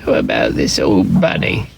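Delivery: a hint of an old nurse voice; Nana asking. A narrator's reading. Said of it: "she asked".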